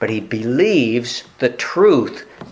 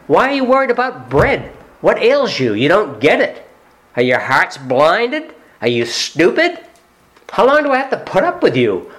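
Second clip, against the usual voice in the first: loud, high, exasperated scolding; angry Jesus; 9 semitones higher, 3 dB louder than usual. Acted. Jesus scolding disciples in the boat about bread, loud exasperated rebuke. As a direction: let the frustration boil over at the disciples. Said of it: "He's angry".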